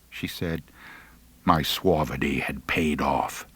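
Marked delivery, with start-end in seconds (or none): none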